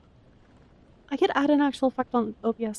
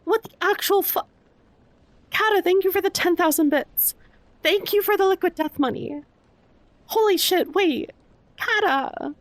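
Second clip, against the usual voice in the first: high, stunned exclamation; real astonishment, pitched up; shocked gratitude; 5 semitones higher, 3 dB louder than usual. Real, not acted. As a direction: burst out in disbelief at a friend's generosity. Shocked by a huge donation.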